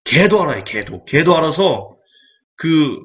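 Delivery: usual speaking voice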